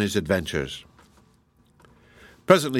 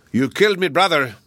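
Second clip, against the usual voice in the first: high, louder furious giant roar; 6 semitones higher, 4 dB louder than usual. Acted. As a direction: bellow it at the boy who killed your brother. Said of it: "he roared".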